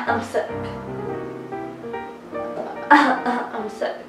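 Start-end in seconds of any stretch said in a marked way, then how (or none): none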